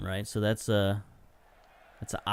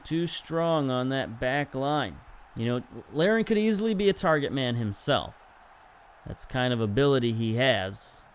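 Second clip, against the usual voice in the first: ordinary delivery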